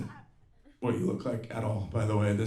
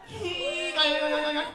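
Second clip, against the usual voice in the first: very high, stretched-out parody hello; singsong mock greeting, held long; mock influencer greeting. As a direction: squeal it like a caricatured vlogger. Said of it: "those that starts like this".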